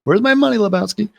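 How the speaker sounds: high-pitched mock demand for money; mock debt collector.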